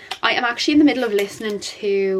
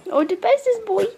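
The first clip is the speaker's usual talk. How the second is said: high, squeaky cooing pet voice; 13 semitones higher than usual. Real, not acted. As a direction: coo to the dogs like babies. Cooing at the dog.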